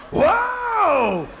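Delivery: huge high drawn-out "whoa"; wife's labor yell. Retold or imitated. Husband retells his wife's first labor pain, huge high yell. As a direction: howl it out at full volume.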